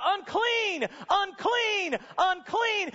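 high, ringing mock cry of a leper; acted leper's shout. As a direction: call it out as the outcast warning the crowd. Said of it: "They shout".

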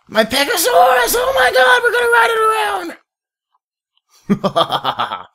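very high screaming joy; slow, screaming excitement ending in laughter; ecstatic shout. Real, not acted. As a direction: shriek with delight. Ecstatic flying horse discovery.

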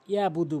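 ইয়াবুদু with no ayn; smooth open "yaa-budu"; common mispronunciation. Shown as a demonstration. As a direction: glide through ইয়াবুদু without a throat catch. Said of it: "আইনের উচ্চারণ করেই না".

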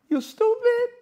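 high, hammed-up woman's reaction; mock high retort. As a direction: squeak it like a giggling woman.

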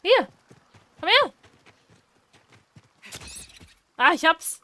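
high, loud triumphant call; excited chase. Real, not acted. Gamer catching a hidden rat, triumphant shout. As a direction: pounce on the rat.

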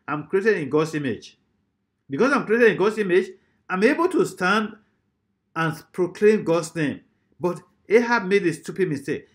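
usual speaking voice

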